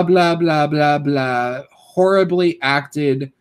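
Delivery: ordinary delivery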